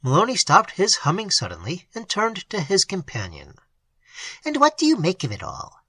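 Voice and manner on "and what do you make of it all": abrupt question. A narrator's reading. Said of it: "he asked abruptly".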